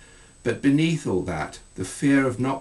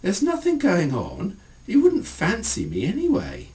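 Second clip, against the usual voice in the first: much higher, grinning denial; 11 semitones higher, 4 dB louder than usual. Acted. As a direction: protest with a wide grin, much higher. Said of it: "grinning wildly".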